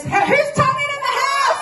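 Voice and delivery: calling out. Real, not acted. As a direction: shout it out to the room. Calling out to the crowd.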